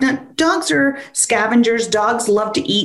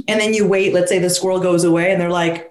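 normal talking voice

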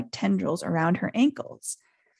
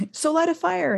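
choking; 9 semitones higher than usual. A narrator's reading. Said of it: "Harry choked".

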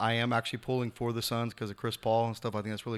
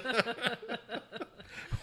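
real high-pitched, soft laugh; 13 semitones higher, 6 dB quieter than usual. Real, not acted. Podcast host amused as the show wraps up, real laughter.

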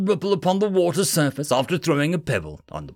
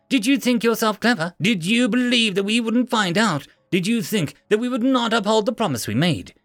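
contemptuous; 6 semitones higher than usual. Acted. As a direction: spit the questions with scorn. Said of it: "hissed out in contempt".

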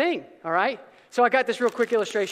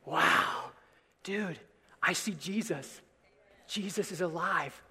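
much softer, lower, in awe; awed hush; 5 semitones lower, 11 dB quieter, slower than usual. Retold or imitated. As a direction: breathe it out in wonder. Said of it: "greatest compliment ... give the church".

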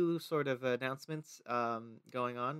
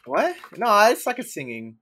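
high, loud startled protest; surprised; 9 semitones higher, 13 dB louder than usual. Real, not acted. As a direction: blurt it out, caught off guard. Asked to sing on the spot.

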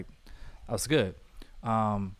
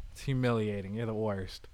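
lower, softer, dry muttered insult; mock deadpan mutter; 4 semitones lower, 8 dB quieter than usual. Podcaster voices Loki sulking at Thor, low and soft. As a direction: grumble it like a sulking brother.

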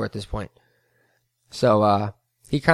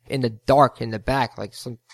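normal talking voice

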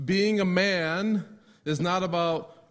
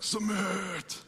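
quieter, higher gloating Submit; smug husbands; 4 semitones higher, 4 dB quieter than usual. Retold or imitated. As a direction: savor the word Submit like a husband who just won. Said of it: "some of you husbands are like".

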